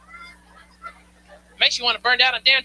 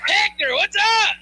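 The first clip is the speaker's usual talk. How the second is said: friendly greeting; 6 semitones higher, slower than usual. Retold or imitated. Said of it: "And it's like".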